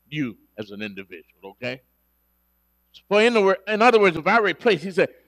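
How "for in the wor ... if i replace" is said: loud, rising preacher's emphasis; preaching fervor. Acted. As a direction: drive the point home to the congregation.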